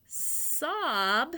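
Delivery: "Sob" said high and loud; emphatic word. Shown as a demonstration. Teacher stressing a new word for kids. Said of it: "sob means you're crying really hard".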